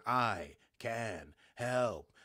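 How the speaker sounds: slow, drawn-out mysterious machine voice; eerie message voice. Acted. The mysterious helper's message on Sung's screen, slow and eerie. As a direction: stretch each word like a ghostly screen message.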